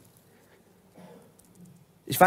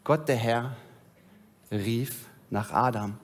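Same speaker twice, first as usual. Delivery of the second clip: gently lowered storyteller voice; scripture reading; 2 semitones lower than usual. A narrator's reading. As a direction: read the fall story steadily to the congregation. Reads the Genesis story aloud.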